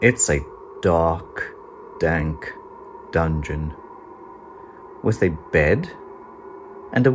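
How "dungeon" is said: ordinary delivery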